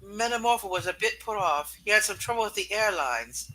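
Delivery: ordinary delivery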